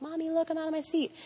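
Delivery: put-on high, quick, pleased little-boy voice; proud little boy. Son boasting to mom he's free, high and quick. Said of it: "he was like".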